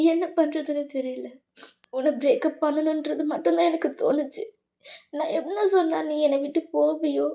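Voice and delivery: ordinary talk, nothing marked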